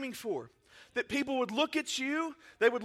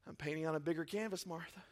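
calm Jesus; 7 semitones lower, 12 dB quieter than usual. Retold or imitated. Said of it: "And he goes".

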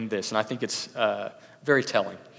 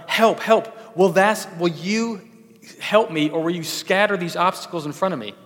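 higher, louder retold plea for help; half-acted desperate plea to God; crying for help; 5 semitones higher, 5 dB louder than usual. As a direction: call out as if swept away. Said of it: "she exclaimed".

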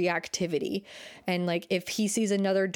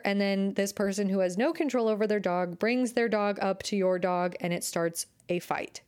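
usual speaking voice

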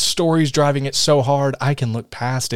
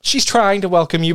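ordinary delivery

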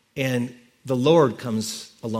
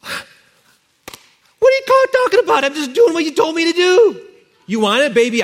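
much higher, louder, fast defensive protest; flustered husband; 14 semitones higher, 6 dB louder than usual. Acted. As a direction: squeak out your innocence. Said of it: "I could feel Abraham saying".

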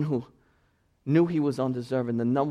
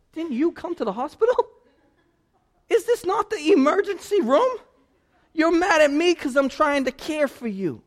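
higher, louder exasperated pleading; doctor who can't believe the patient; 12 semitones higher, 6 dB louder than usual. Retold or imitated. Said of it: "The poor doctor is sitting there like".

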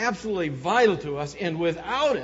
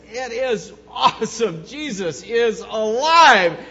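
joyful proclamation; 5 semitones higher, 9 dB louder, slower than usual. Retold or imitated. Congregation's Easter cry retold.

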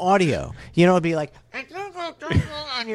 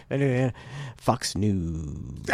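much lower, quiet drawn-out "Fox News"; low newscaster sign-off; 5 semitones lower, 7 dB quieter, much slower than usual. Retold or imitated. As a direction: imitate the anchor's gimmick sign-off. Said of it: "he's like".

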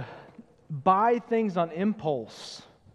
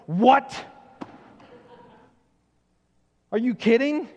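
higher, louder mock disbelief; mock shock; 5 semitones higher, 5 dB louder than usual. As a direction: act stunned that a newer phone is out. Shocked about newer phone.

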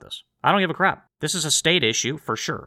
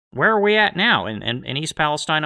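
ordinary delivery

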